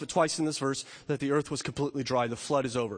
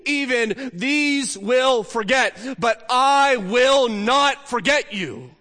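slow, loud and high, each word weighted; solemn proclamation; 6 semitones higher, 6 dB louder, much slower than usual. Acted. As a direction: declare it as God's promise. Voicing God's answer in scripture.

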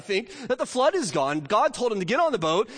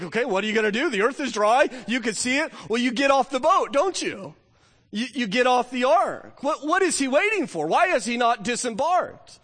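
high, puzzled rhetorical questioning; incredulous; 6 semitones higher than usual. Acted. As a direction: ask it in disbelief. Incredulous rhetorical questions about Noah.

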